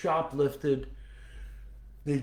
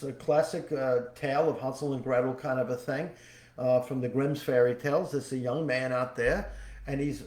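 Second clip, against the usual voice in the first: usual speaking voice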